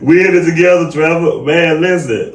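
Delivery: high and loud, laughing as he calls Trevor; rally your friend, all fired up; pumped-up shout to Trevor, high and loud; hyped excitement. Real, not acted. Hyped call-out continues after laugh.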